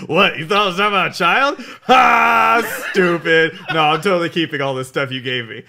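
loud, incredulous outburst with a big HA; loud mock derision; scoffing mockery. Acted golf club character scoffing.

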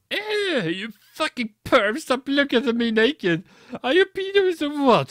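high, loud outraged yelling; screaming. Acted. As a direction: scream at the peeping perverts. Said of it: "I screamed".

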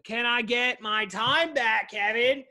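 high, loud, drawn-out mock demand; imitated complaining listener. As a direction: play an indignant customer, stretched out and loud. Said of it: "You're like".

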